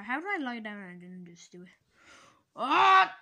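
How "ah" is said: short sharp yelp of surprise; startled scream. Pretend. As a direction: yelp as if falling in. Character falls into the ice cream.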